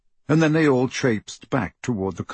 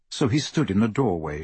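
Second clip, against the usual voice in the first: plain reading voice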